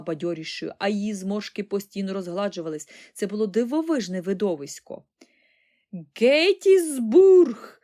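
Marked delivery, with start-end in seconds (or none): 6.2-7.8 high, drawn-out yell; very loud, stretched-out cry; exclaiming old woman. A narrator's reading. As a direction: holler it like battle news. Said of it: "вигукнула вона".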